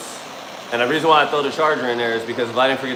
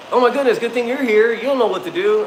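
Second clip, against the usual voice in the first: high, breathless mock-relieved woman's voice; relieved neighbor; 12 semitones higher, 5 dB louder than usual. Neighbor tells Beans he'll know what to do, high and gushing. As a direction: imitate her gushing relief, voice pitched up.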